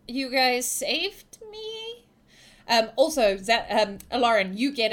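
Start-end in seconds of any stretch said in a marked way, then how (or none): 0.1-2.0 slow, drawn out with pitch lifting; stretched, rising disbelief; hesitant, puzzled. Acted. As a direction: trail upward as if unsure. Shaken girl voiced by game master.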